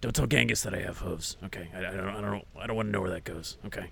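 low hushed aside; secretive whisper. Acted. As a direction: keep it hidden from Genghis.

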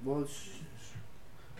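ordinary talk, nothing marked

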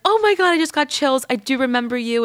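high, gushing retold reply; retold excitement. Retold delighted reply to her message.

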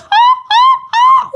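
loud, very high squawking laugh; seagull laugh. Shown as a demonstration. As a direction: laugh in shrieking gull calls. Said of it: "sound like a seagull when I laugh".

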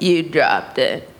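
low, slow store clerk voice; store staff. Retold or imitated. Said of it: "And they were like".

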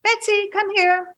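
high sing-song call to a pet; high-pitched dog call. Real, not acted. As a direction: coax a little dog over in a high voice. Calling her dog over.

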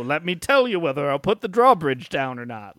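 a hint of a grand critic; lightly voiced lofty judge; mock haughty critic; pompous critic. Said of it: "their job is to be the gatekeeper".

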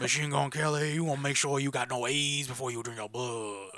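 lightly put-on vampire accent; Louisiana vampire accent. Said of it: "Don't do the accent".

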